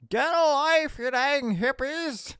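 high mock yell, drawn out; yelling out the window. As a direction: yell it to passing protesters from a car. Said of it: "just yell out the window".

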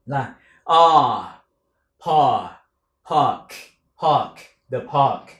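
"park" built up from the vowel, long and open. Shown as a demonstration. Teacher drilling park for learners, long vowel. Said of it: "Âm này âm A dài luôn".

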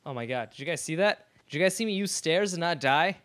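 loud amazed disbelief; thrilled he survived; excited. Real, not acted.

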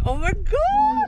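high, slow delighted oh my God; thrilled squeal. Real, not acted. Finds reserved parking.

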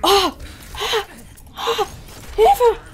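frantic high cries for help; panicking gamer. Real, not acted. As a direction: shriek in panic as you die. Wird im Spiel getötet. (panischer Hilferuf eines Gamers)

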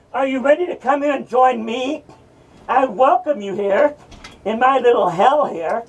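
ordinary talk, nothing marked

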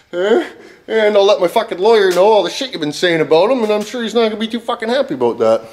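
high, loud put-on voice gloating over views; hammed-up high rant. Gloating to the camera about views, high and loud. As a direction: ham it up in a high, loud showoff voice.

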